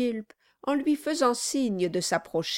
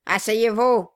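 gruff order; 2 semitones higher, 7 dB louder than usual. Acted. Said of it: "dit le nain".

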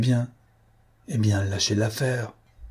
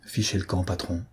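much softer, hushed warning; low aside; 3 semitones lower, 7 dB quieter than usual. A narrator's reading. Inspector quietly urges his boss to slip away unnoticed. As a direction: murmur it so no one overhears. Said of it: "tout bas".

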